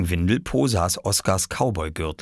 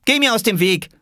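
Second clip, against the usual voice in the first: put-on very high, louder girl's voice; high, forceful little-girl command; child ordering; 5 dB louder than usual. Girl orders boy aside.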